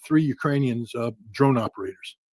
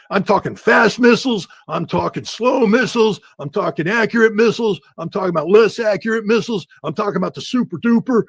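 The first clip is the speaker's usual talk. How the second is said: mock boasting; 7 semitones higher, 4 dB louder than usual. Mocking Trump boast continues.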